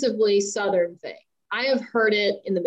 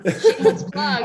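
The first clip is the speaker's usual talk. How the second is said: laughing; 13 semitones higher, 3 dB louder than usual. Real, not acted. Laughing through a self-promo plug.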